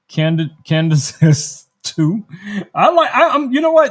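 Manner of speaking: breaking into laughter, pitch leaping up; giggly, excited outburst while talking; real laughing. Real, not acted. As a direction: laugh through the words, high and loud. Amused reading viewers' votes.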